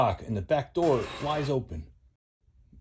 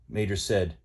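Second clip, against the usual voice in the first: ordinary delivery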